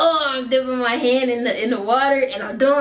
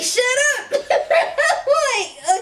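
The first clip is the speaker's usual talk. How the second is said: very high laughing; 14 semitones higher, 3 dB louder, much slower than usual. Real, not acted. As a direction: crack up as you say it. Laughing retelling Vince.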